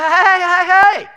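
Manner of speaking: loud, high acted objection; mock-stern shout to stop someone; calling out sharply. Acted objection to guest's swearing.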